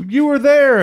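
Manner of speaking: high, loud accusing cry; mock alien shout. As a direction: shout it like an interrogator. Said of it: "and they go".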